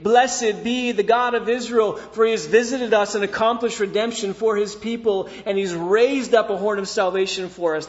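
high, loud, ringing declaration of praise; full-force scripture proclamation. Acted. Preacher reads Zechariah's praise to the congregation, loud and exultant. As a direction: proclaim it boldly to a whole congregation.

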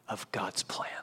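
hushed, breathy ending; whisper. Acted. Preacher hushing the congregation with a whisper. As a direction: drop to a whisper on the last words. Solemn dramatic drop.

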